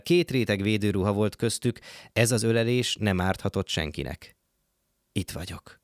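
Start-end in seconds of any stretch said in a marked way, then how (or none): 5.1-5.8 soft, hushed half-whisper. A narrator's reading. Garcia softly reassures the frightened girl, hushed. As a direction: say it gently, close, barely voiced. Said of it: "suttogta Garcia".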